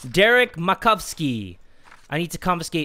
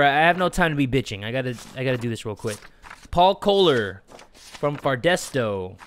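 normal talking voice

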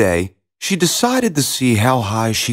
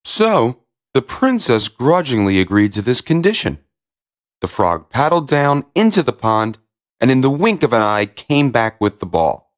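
plain reading voice

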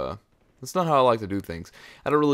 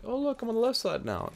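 off the other side. Shown as a demonstration. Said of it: "if you hear me, like, talking over here".

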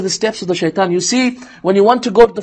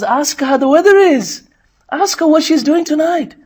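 prompting shaitan voice; 9 semitones higher than usual. Retold or imitated.

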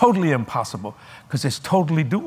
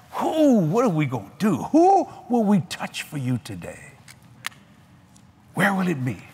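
excited; 3 semitones higher than usual. Acted. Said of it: "excited, passionate".

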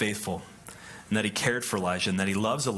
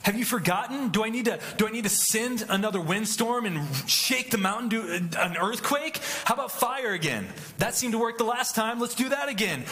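mock exasperated God; 10 semitones higher than usual. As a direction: pitch climbing far higher, building from questioning to sarcastic. Preacher voices God mock-showing power.

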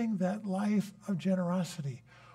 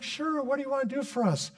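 put-on girls' voice; 10 semitones higher than usual. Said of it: "And they're like".

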